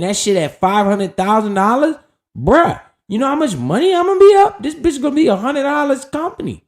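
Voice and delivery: high, loud excited burst, pitch way up; hyped. Real, not acted. Vlogger hyping viewers about future Bitcoin gains, high excited burst. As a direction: rave about the payoff like it already happened.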